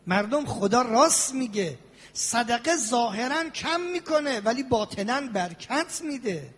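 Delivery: ordinary delivery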